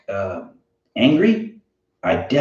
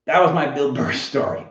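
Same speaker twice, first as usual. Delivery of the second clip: ordinary delivery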